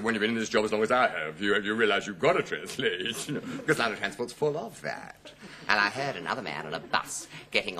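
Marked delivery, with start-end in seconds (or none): 0.0-5.3 lightly voiced ticket clerk; a hint of a worldly clerk explaining; booking-office man. West Indian booking clerk to a customer, lightly voiced. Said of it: "And he said".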